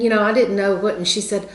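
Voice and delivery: ordinary talk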